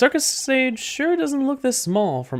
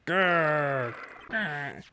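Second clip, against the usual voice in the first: tiger growl; 6 dB quieter than usual. Acted. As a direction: growl like a big cat lurking.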